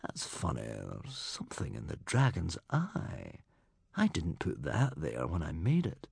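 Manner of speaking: low, hushed inner musing; quiet, low, wondering thought; thinking to himself. A narrator's reading. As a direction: puzzle it over softly to yourself. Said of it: "he thought".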